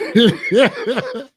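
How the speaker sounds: bursts out in a high laugh; can't hold the laugh in. Real, not acted. Laughing at his crazy prison story, high laugh.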